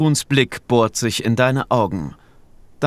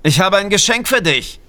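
calling out; 8 semitones higher, 4 dB louder than usual. A narrator's reading. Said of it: "rufst du".

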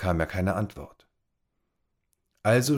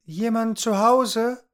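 calling out; 4 dB louder, slower than usual. A narrator's reading. Tom calls into empty house.

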